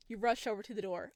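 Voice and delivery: ordinary talk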